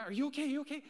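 loud, high, breathless checking; acted out of breath. Winded parent checking on fallen daughter, acted breathless urgency. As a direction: re-enact an urgent check between puffs. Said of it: "she's noticing I'm out of breath".

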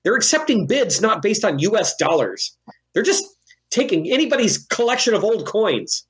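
high-pitched indignant griping; incredulous, high-pitched grumbling; loud complaining. Acted. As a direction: complain for the whole room to hear. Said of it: "He's just going to loudly complain to him".

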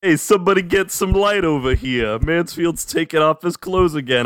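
put-on lightly done Brooklyn accent, pitched high. Podcast host jokes about his co-host stripping, Brooklyn accent. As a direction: call out like a Brooklyn guy. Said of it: "I don't know why I went to a Brooklyn accent".

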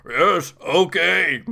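slow, cracked, laughing ghoul. Acted. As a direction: drag it out, half laughing.

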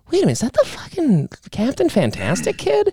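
high-pitched double take; retold disbelief. Spotting an actor from the car, incredulous. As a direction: act out a dawning, incredulous recognition.

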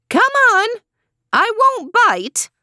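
very high coaxing screech; screechy old woman. A narrator's reading. Old woman urging the man inside, high and screechy. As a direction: coax him in a shrill old voice. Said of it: "It was high and screechy".

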